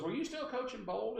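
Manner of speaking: high imitation of her accent; Barbara Delano. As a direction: ask as the caller, in her accent. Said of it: "I have to use her accent".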